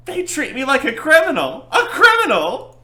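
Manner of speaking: high, loud, hammed-up outrage; ranting witness. Witness protesting his treatment in court, loud and outraged. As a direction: blow up in an indignant high-pitched rant.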